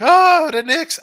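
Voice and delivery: high, loud mocking fan squeal; mock Knicks fan. Mocking a friend who cheers the Knicks, in a high loud squeal.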